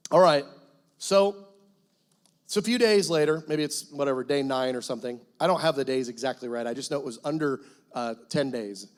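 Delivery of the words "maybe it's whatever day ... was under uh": normal talking voice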